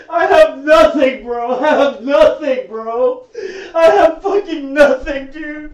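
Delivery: slow, tearful words breaking into sobs; real crying. Real, not acted. Streamer who lost all his money, crying. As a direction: cry it out, defeated. Streamer broke after losing everything.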